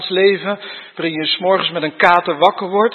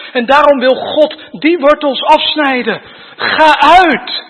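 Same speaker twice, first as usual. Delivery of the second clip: raised, driving sermon voice; preaching at full force; 5 semitones higher, 3 dB louder than usual. Acted. Preacher urging the congregation, at full force. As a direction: preach it loud and urgent to the congregation.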